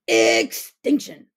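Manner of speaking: dramatic. Acted. Dramatic story conclusion.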